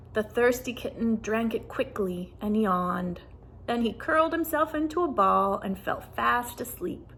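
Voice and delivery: usual speaking voice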